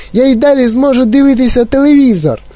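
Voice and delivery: put-on very high defiant little boy; tiny squeaky voice. A narrator's reading. Shrunken boy defying his parents, squeaking. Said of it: "пискнув тоненьким голосочком".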